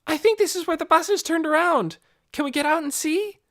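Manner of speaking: high, loud eager voice; girl asking to search. Acted. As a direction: plead to get out. Nikki eager.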